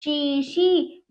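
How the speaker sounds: चीशी slow, both ई held long; drawn-out vowels. Shown as a demonstration. Teacher reads the word to children, drawn out. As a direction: stretch each syllable of चीशी. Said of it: "हम इसको खींचेंगे".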